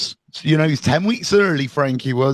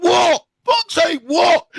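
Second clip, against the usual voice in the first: retold exasperated shout, much higher; irritated shout; 11 semitones higher, slower than usual. Retelling his reply to his son, exasperated shout. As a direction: snap back at a child calling.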